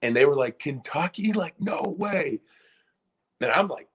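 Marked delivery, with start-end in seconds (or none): 0.6-2.4 disbelieving. Retold or imitated. Friends react to Calipari news.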